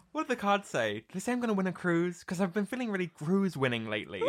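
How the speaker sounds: quick, bright, giggly Ashley; giddy quote. Retold or imitated. Ashley asking Madame Z about a cruise, giddy and quick. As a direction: rush it out with hopeful glee. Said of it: "she's like".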